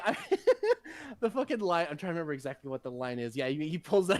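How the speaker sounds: ordinary talk, nothing marked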